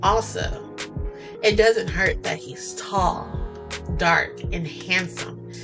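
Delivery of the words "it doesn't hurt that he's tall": plain reading voice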